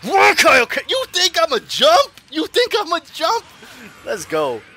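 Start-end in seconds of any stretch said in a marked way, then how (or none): 0.1-3.4 loud, high-pitched challenge; heated taunt. Real, not acted. Player to opponent in a fighting game, heated taunt. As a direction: throw it at the opponent, raised voice.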